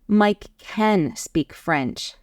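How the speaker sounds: slow, with weighted "can"; stress on CAN. Shown as a demonstration. Teacher giving an example of certainty, stress on "can". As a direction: lean hard on "can". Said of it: "use these to emphasize certainty".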